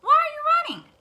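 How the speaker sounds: high loud gobbling question; turkey voice. Acted. Turkey questions the hen and duck. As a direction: call out to the runners.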